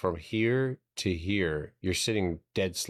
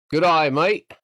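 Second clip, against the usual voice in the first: loud, high, hammed-up Australian greeting; mock Australian accent; 9 semitones higher, 9 dB louder than usual. Streamer teasing any Australians in chat, loud mock Australian accent. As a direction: belt out a cartoonish Australian hello. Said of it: "I'm sorry, if you're Australian".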